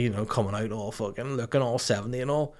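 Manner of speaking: ordinary talk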